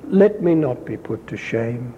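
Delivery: ordinary delivery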